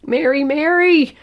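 slow, high, very loud scream; full scream. A narrator's reading. Mother at the locked bathroom door, full scream. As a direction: scream the name as loud as you can. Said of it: "I screamed as loud as my voice would allow".

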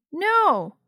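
put-on high, loud child's no; defiant agreement. A narrator's reading. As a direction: refuse loudly in solidarity. Sisters defiantly agree to ignore brother.

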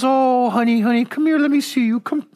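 put-on sweet old woman in a rocking chair calls the teens over, crooning; sweet old lady. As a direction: coo like a sweet grandma beckoning. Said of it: "and she goes".

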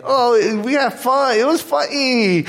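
put-on high, gleeful little-kid voice; excited kids. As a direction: squeal it like a child reporting to parents. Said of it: "And they're like".

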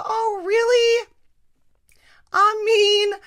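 high, loud sarcastic cry; hammed-up indignant shout; mock outrage.

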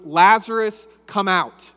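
loud call. A narrator's reading. Jesus calls Lazarus out of the tomb, a loud ringing call. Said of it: "called out in a loud voice".

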